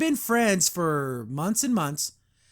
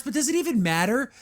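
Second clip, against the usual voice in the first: normal talking voice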